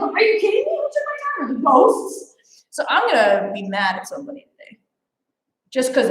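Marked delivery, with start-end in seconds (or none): 0.0-2.4 high-pitched mock outrage at the missing timer; retold disbelief. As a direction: squeak it out, half laughing. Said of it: "I was like".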